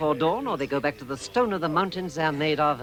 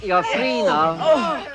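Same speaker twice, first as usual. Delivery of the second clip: loud, higher coaxing call; 7 semitones higher, 6 dB louder, slower than usual. Acted. Wizard calling the freed dwarves out, loud and encouraging. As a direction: call them out of the sacks.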